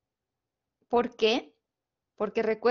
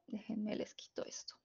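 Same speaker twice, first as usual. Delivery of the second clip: whisper; 3 semitones lower, 18 dB quieter than usual. Real, not acted. Teacher's aside while fixing screen.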